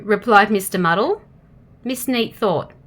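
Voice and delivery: usual speaking voice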